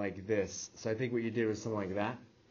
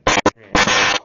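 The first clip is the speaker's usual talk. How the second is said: much louder, calling over the class; teacher calling the class to order loudly; loud repeat; 5 semitones lower, 20 dB louder than usual. Real, not acted. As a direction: raise the voice so the whole room hears.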